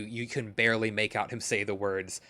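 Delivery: usual speaking voice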